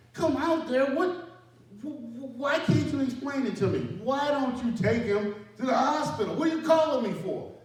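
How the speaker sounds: high, loud, frustrated yelling; angry shout. Retold or imitated. Sergeant yelling at his team leader over the phone, furious. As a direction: blow up at him down the line.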